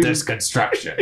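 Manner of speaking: put-on old-timey voice. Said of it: "Doing old-timey voice".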